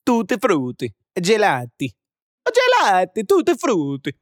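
lilting, hammed-up Italian; Italian accent. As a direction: roll it out like a stage Italian. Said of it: "done doing that".

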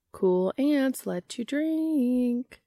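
sing-song it like a playground taunt; teasing sing-song singalong. Acted. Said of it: "said Chrissy in a sing-song".

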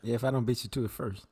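ordinary talk, nothing marked

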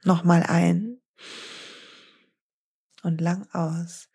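slow, calm breathing instructions with long pauses; soothing yoga teacher counting breaths; guided breathing. Acted. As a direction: guide the listener through each breath, unhurried. Leads a relaxing breath exercise.

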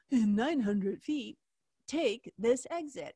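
slow, measured GPS assistant; Siri voice. Acted. Siri directing a driver off the highway, imitated assistant voice. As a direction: imitate a robotic navigation prompt, word by word. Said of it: "you hear the Siri voice go".

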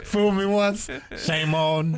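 mock Southern president, drawn out; a lightly voiced folksy Bush fumbling the saying; George Bush impression. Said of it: "when he goes".

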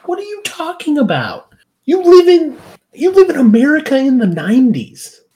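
high, exasperated rant rising to loud; hammed-up indignant outburst; mock outrage. Acted exasperated outburst at characters.

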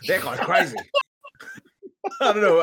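cracking up mid-line, voice jumping high; laughing. Real, not acted. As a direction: lose it and laugh through the words. Breaks up laughing in character.

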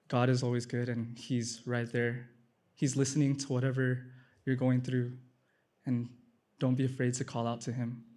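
normal talking voice

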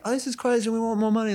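high-pitched mock whinge from the players; imitated complaint. Imitating players' association demanding pay.

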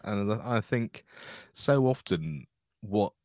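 normal talking voice

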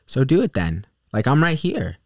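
high, loud dare; defiant challenge. Retold or imitated. Daring the driver to fight, high and loud. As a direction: stand your ground and taunt him. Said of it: "And I'm like".

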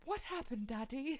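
put-on high, small child putting on British; British little-kid voice. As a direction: imitate an innocent kid with a British accent. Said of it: "Why are you British?".

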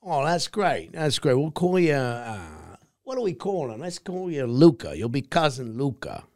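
director quote. Retold or imitated. Actor imitating the director casting him, enthusiastic. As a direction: gush like a delighted director. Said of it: "and he said".

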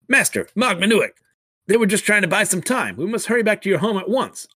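a loyal aide hurrying the master along; urgent servant. Acted. Servant warning Mag Manuik to hurry home, urgent and high.

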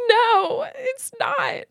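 very high mock wail; retold childhood sobbing. Child denying that dad is a sloth, mock sobbing. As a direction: imitate a kid wailing in denial. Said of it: "I'm just sobbing as a kid".

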